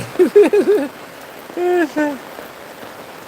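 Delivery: high breathless laugh. Real, not acted. Dog escapes, he laughs.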